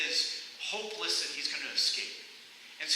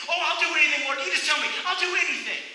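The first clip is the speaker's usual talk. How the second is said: high, loud, breathless eagerness; eager pleading; 9 semitones higher, 4 dB louder, faster than usual. Acted. Jack on the cliff eager to obey God, loud pleading. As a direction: promise anything, all in a rush.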